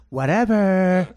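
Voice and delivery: slow, drawn-out mock demand; put-on sulky son dragging out every word; son imitation. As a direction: caricature of a son whining for food. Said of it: "that's how he talks".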